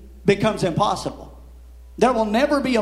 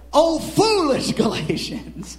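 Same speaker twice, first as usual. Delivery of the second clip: slow, higher exclaimed rebuke; emphatic scolding, drawn out on FOOLISH; not timid; 4 semitones higher, slower than usual. Shown as a demonstration. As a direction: stretch it out as a bold cry. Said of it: "He wasn't saying it timidly".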